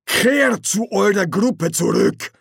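slow, high, loud commanding guard; guard leader. Acted. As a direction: order them off, word by word. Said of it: "Der Anführer knurrte".

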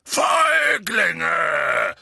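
loud, drawn-out furious bellow; enraged giant's roar; roaring Hagrid. A narrator's reading. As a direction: bellow it across the grounds. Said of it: "brüllte Hagrid".

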